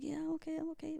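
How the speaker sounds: retold small-voiced "I'm fine"; acted brush-off.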